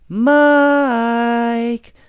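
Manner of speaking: a long, loud, high call of the name; mother anxiously calling Mike. Acted. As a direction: shout across the snowy yard for a lost child. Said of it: "called Missus Brown as she hurried".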